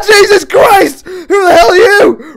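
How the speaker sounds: high, drawn-out, very loud screech; terrified screaming. Real, not acted. Player confronting a sudden stranger, screaming in fright. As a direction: shriek the words in shock.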